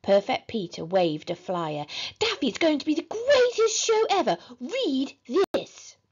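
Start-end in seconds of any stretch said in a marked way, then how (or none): 2.2-6.0 put-on high, loud child's excitement; eager young fan; gushing little brother. A narrator's reading. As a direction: show it off, thrilled and pitched up. Little brother gushing over show.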